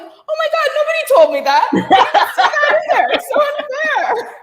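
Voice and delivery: high, loud comic wail through laughter; mock despair. Artist mock-complaining to the host about being unfunny, high laughing wail. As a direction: wail it like a jokey tantrum, laughing. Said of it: "And I was like".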